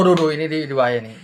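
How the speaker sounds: ordinary talk, nothing marked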